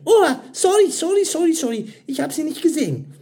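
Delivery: very high, rushed apologies; flustered apology. A narrator's reading. Mira apologises after bumping a man.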